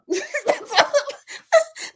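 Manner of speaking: high giggle; high laugh. Real, not acted. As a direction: giggle at yourself. Laughing at own joke.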